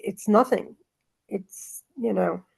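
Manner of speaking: ordinary talk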